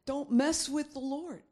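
usual speaking voice

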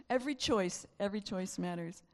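usual speaking voice

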